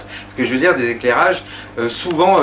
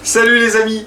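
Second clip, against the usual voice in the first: bright, raised show greeting; booming kids show opener; 9 semitones higher, 3 dB louder than usual. Acted. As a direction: open the show like a kids' host. Host's real show greeting.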